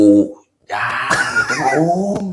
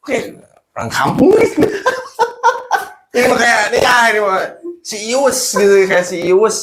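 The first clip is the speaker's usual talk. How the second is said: laughing; 14 semitones higher than usual. Real, not acted. Laughing mockery of himself.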